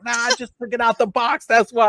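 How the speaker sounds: high, loud mock protest; protesting excuse. Host retelling his friend's excuse, protesting. Retold excuse from Smurf One.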